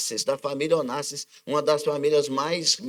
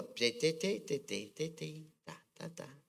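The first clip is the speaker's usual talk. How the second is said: petals falling away; 12 dB quieter, slower than usual. Retold or imitated. Flower plucking petals, fading.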